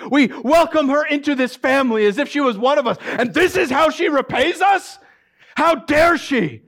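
high, loud, furious ranting; boiling over with rage; father rages that his son's bride has shamed the family, furious. Acted. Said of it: "He was furious".

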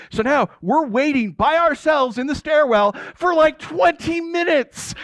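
high, incredulous storytelling; exasperated. Retold or imitated. Teen left waiting in the stairwell, exasperated disbelief. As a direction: stretch out the last words in comic disbelief. Exasperated retelling of long wait.

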